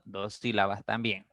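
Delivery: usual speaking voice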